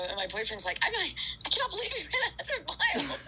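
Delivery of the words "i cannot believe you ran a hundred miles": high, fast and laughing with disbelief; laughing high-pitched disbelief; elated laugh. Retold or imitated. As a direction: squeal it through a laugh of joy.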